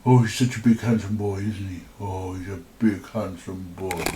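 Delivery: low, softening coo to a pet; doting pet talk. Real, not acted. Admiring the cat on camera, tender cooing. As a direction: fuss tenderly over a cat, voice dropping.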